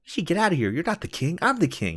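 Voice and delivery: high-pitched, indignant mock argument; squabbling over kingship. Rival kings squabbling in a tree, high mock indignation.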